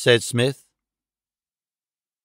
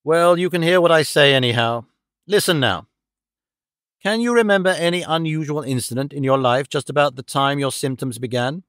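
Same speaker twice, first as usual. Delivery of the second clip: ordinary delivery, nothing marked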